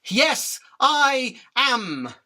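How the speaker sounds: defiant shout. Acted. Insect yells his defiance back at the taunting spider. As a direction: throw it back at her at full pride.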